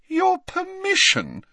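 high, incredulous scoff. A narrator's reading. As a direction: throw it back with high-pitched scorn. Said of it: "scoffed Ginny".

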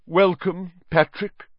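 mildly stiff, high greeting. A narrator's reading. Ghost host greets his rival, stiff. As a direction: welcome him with forced politeness. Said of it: "said Nick stiffly".

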